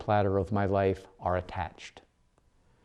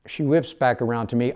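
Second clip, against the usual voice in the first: ordinary delivery, nothing marked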